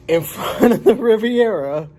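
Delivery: words pushed through a laugh; amused, laughing delivery. Real, not acted. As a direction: chuckle at the coincidence as you say it. Laughs retelling coincidence.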